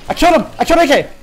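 high, loud cry of triumph; excited shout. Real, not acted. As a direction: yell the kill out to the team. Announcing a kill mid-fight.

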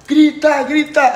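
retelling a teammate's high, loud call for the crowd to shout; mock yell. Coach retelling Daniel urging the crowd, high loud mock yell. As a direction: yell it high and loud, imitating him hyping the fans. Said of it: "mandando ele gritar".

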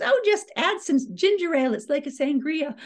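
high, bright imitation of mom; mom's voice. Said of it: "She goes".

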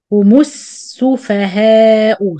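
slow, Humussu with the mim as damma and a long s; slow Quran recitation demo; joined mim with damma. Shown as a demonstration. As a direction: draw out Humussu slowly, feeling the sin. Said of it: "feel the Rakhawa of the first Sin".

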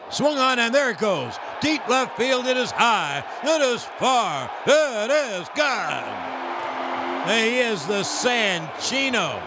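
rising play-by-play to a shout; home run call. Acted. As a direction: build the call to a roaring finish.

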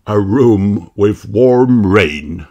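slow wistful daddy voice; dreamy dad. A narrator's reading. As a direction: savour the idea longingly.

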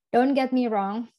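normal talking voice

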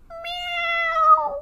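very high drawn-out meow; crying meow. A narrator's reading. Hetty stuck in the door, crying meow. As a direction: wail like a trapped cat. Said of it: "she cried".

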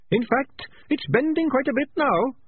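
high, small, oddly calm rat; a tiny chirpy voice, unbothered; little rat voice. A narrator's reading. Sardines from the trap.

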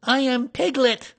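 high, loud insistence; small animal loudly asserting who he is. A narrator's reading. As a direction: declare the name loudly up high. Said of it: "said Piglet loudly".

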